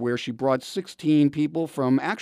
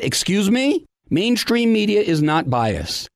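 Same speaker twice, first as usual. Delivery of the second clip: punchy scripted commentary voice; radio commentary read; 4 semitones higher, 5 dB louder than usual. Acted. Recorded commentary segment read.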